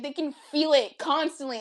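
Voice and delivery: ordinary talk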